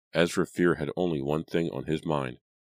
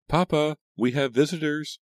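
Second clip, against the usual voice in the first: high, louder call to her father; 12 semitones higher, 4 dB louder than usual. A narrator's reading. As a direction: call out to papa inside the house.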